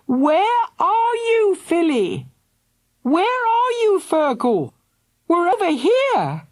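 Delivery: high, loud calls; calling out. A narrator's reading. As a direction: call across the noise. Parents and children calling out.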